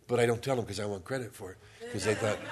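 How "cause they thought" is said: ordinary delivery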